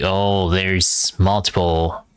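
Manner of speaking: normal talking voice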